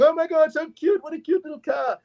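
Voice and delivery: very high cooing squeal; excited kids. Retold or imitated. Kids photographing the tiny car, high-pitched gushing. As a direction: gush like kids over something adorable. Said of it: "The kids are over there".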